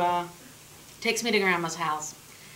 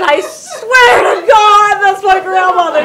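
loud high pleading, laughing; shouting desperately; 12 semitones higher, 13 dB louder, much slower than usual. Retold or imitated. Girl begging the police, desperate shout. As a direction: yell it slowly like your life depends on it. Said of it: "I said".

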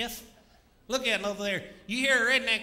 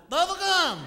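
hollered; 7 semitones higher, 7 dB louder than usual. Retold or imitated. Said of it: "if I holler".

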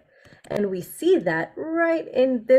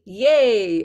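excited yay; 6 dB louder than usual. Shown as a demonstration.

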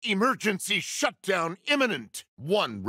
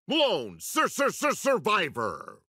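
stuttering like a broken recording; glitching announcer; 4 semitones higher than usual. Acted. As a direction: announce it with the syllable skipping. Glitching game announcer repeats syllables.

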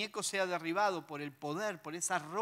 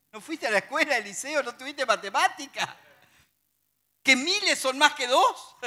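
louder, higher scoffing, ends in a laugh; mock sarcasm; 6 semitones higher, 7 dB louder than usual. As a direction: tease Eliseo for bad math. ([name] se burla de Eliseo, sarcástico)

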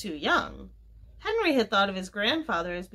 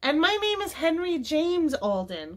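high, proud-sounding exclamation; excited cry; 8 semitones higher, 3 dB louder than usual. A narrator's reading. Henry chiming in after his grandfather, excited cry. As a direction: call out the name, pitched high. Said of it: "cried Henry".

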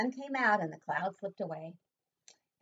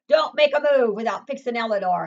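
louder stern command; mock roar; 9 dB louder than usual. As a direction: scold the children firmly. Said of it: "Hazel's mother roared".

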